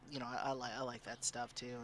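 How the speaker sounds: normal talking voice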